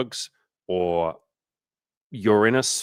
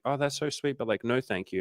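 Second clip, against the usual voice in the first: high, soft imitation of a woman; polite refusal; 5 semitones higher, 5 dB quieter than usual. Imitating a woman turning down a drink, sweetly polite. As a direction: let him down gently in a lifted voice.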